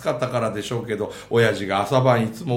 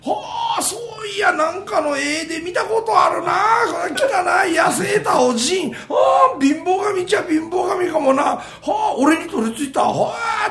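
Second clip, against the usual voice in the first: much higher, loud amazed exclaiming; astonished man; 3 dB louder than usual. Acted. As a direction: gasp out amazement at the spirit. 驚く男の台詞. (貧乏神を見て驚く男、高く大声で)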